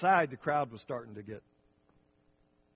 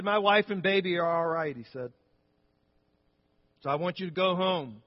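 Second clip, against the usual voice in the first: preaching to crowd; 5 dB louder than usual. Retold or imitated. King addresses angry crowd.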